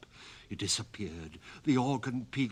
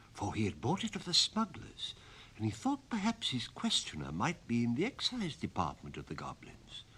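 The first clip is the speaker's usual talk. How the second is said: usual speaking voice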